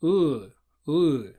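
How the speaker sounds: odd back uh vowel, like E pulled back; short back uh sounds; Russian ы vowel. Shown as a demonstration. Teacher demonstrating the odd Russian vowel. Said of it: "It sounds like".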